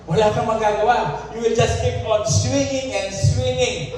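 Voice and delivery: high-pitched, insistent pulpit delivery; impassioned preaching. Real, not acted. Preacher warning the church about powerless effort, impassioned preaching. Preacher drives the point home.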